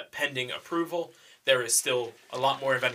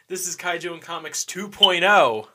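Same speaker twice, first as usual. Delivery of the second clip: slow, louder, higher show announcement; announcer voice; 5 semitones higher, 7 dB louder than usual. Acted. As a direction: announce the new show name. Rebrand announcement.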